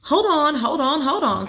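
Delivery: put-on a busy mom calming excited girls; Mom calming kids. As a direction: hold the kids back, lightly.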